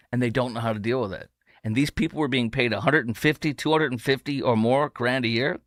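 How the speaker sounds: normal talking voice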